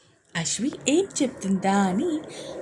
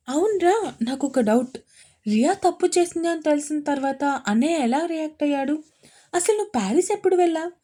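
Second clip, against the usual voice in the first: eager barrage of questions; 6 semitones higher, faster than usual. Acted. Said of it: "ప్రశ్నల వర్షం కురిపించింది".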